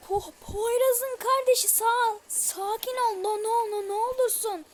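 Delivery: high, loud frightened begging; panicked pleading. Real, not acted. Gamer begging the monster to calm down, panicked and high.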